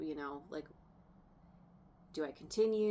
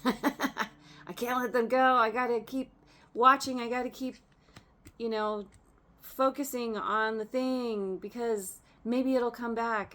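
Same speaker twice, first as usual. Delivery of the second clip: imitating a client who can't let go, higher and louder with a laugh; mock clingy client; 4 semitones higher, 6 dB louder than usual. Tarot reader mocking her viewers as a clingy client. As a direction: play the querent clinging to the ex. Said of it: "they wanna know about".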